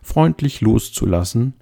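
ordinary delivery

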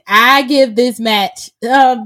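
ordinary talk

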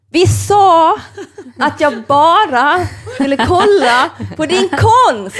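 high, loud protest broken by laughter; indignant laughing retort. Retold or imitated. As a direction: laugh it out in disbelief. Retold retort to a pushy man. (skrattande upprörd replik, hög och stark)

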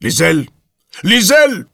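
loud, high angry call; wild man's bellowed summons; roaring. A narrator's reading. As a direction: roar for the servant. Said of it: "שאג הברנש הפרוע".

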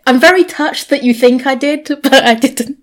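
amused, laughing it off. Real, not acted.

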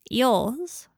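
sneering question. Acted. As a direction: scoff at the idea. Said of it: "with a curling lip".

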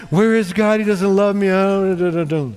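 lightly put-on whine of a fickle believer; a touch of mock self-pity; mock complaining. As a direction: grumble in mock despair, tailing off into babble. Mimics fickle believer when things go bad.

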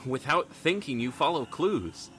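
game master narration. A narrator's reading. Game master narrates to the table, hushed and steady. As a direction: draw the players into the moment. GM sets the scene.

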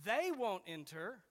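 "they" punched strongly and stretched, slow delivery; stress on THEY. Shown as a demonstration. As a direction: land hard and slowly on "they". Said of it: "When you read it with that emphasis".

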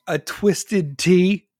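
loud, high, drawn-out disbelief; stretched-out incredulous question. Acted. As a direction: repeat it slowly, appalled. Acted disbelief at the drink.

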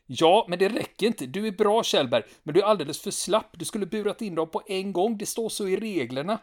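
high, pushy rule-quoting voice; pedantic soldier. Retold or imitated. Stanneman argues with Kjellberg.

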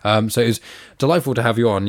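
normal talking voice

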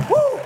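a high, loud whoop of joy; ecstatic woo. Real, not acted. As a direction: whoop it out to the church. Preacher's ecstatic cry.

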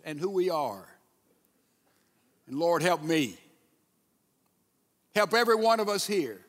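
measured prayer with long pauses; public prayer. Real, not acted. Preacher praying before teaching God's word, earnest and unhurried.

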